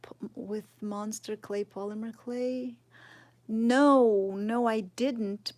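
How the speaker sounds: ordinary talk, nothing marked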